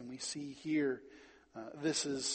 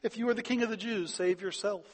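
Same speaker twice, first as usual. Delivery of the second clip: higher, quicker taunt; mocking soldiers; 7 semitones higher, 4 dB louder than usual. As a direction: jeer it out, pitched up, as retold mockery. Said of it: "chimed in to mock him".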